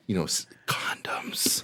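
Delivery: slow hushed whisper. Acted. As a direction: whisper it like a secret. Whispered rude word.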